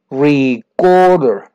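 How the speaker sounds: "Recorder" said very slowly, the middle syllable "cor" stressed. Shown as a demonstration. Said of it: "En el acento en la segunda". ("Recorder" muy lento, con el acento en la sílaba del medio)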